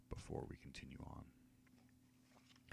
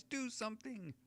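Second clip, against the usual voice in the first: halfling pleading. Acted. Halfling begs again.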